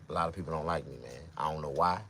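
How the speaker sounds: ordinary talk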